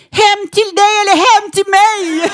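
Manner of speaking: high, loud flirty comeback; cheeky flirt. Retold or imitated. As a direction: toss it back playfully, teasing. Flirtig replik till rasisten.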